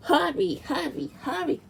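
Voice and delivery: breathless urging; panting. A narrator's reading. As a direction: pant out the words. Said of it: "panted Thomas".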